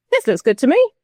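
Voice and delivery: high-pitched breezy mimicry; mock approval. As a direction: approve it glibly, as a send-up. Acting out government's glib approval.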